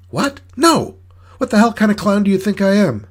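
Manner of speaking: very high, loud outraged protest; shocked indignation. Acted. Clown accused of dognapping by Annie, indignant outcry. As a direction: squawk in disbelief, pitch shooting right up.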